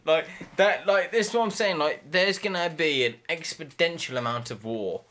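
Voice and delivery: normal talking voice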